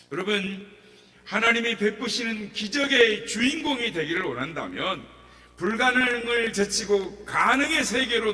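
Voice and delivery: loud, high fervent preaching; rally the congregation, building fervor; preaching at full force. Acted. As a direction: passionate revival appeal.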